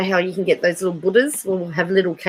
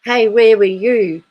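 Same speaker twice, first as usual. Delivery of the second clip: calling out; 3 semitones higher, 7 dB louder, slower than usual. Retold or imitated. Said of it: "if someone says".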